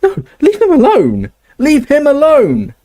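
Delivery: high, loud desperate scream. Acted. Hermione screaming at the snatchers beating Ron. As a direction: scream at them to stop hurting him. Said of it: "Hermione screamed".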